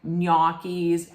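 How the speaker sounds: "gnocchis" as NYAH-keez; slow demo of "gnocchis", ah vowel; common mispronunciation. Shown as a demonstration. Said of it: "most people would say it".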